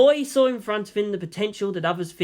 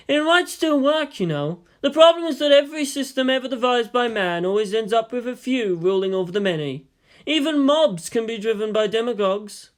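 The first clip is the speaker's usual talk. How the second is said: lecturing teacher; 6 semitones higher, 4 dB louder than usual. Acted. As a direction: list them like a lecture. Yang lecturing.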